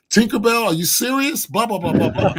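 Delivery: indignant outburst. Retold or imitated. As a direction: vent in disbelief at the name. Furious at friend over nickname.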